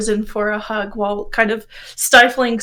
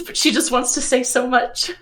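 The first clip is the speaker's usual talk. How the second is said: ordinary delivery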